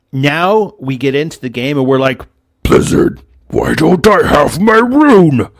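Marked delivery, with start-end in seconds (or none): 2.6-5.5 drawn-out whiny demand, higher and louder; mocking whiny player. Podcaster mocking players demanding runes, whiny and loud. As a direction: whine it out like an entitled player.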